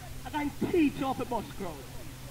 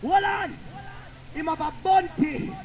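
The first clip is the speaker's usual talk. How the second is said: shouted cut-in; 4 semitones higher than usual. Real, not acted. Selector stopping the music over a bunty. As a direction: cut in sharply.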